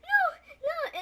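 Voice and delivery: high, loud imitated protest; high repeated cries, half laughing; mock frightened pleading. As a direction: plead in a high put-on panic. Acted pleading while being picked up.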